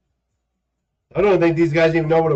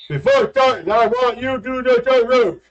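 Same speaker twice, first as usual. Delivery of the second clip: drill sergeant; 8 semitones higher than usual. Pretend. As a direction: shout orders at recruits. Playing recruit and drill instructor.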